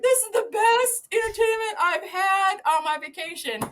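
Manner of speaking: high, loud gushing praise; retold delighted visitor. Husband on overlook to zookeepers, high loud delight. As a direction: call it out as a thrilled spectator.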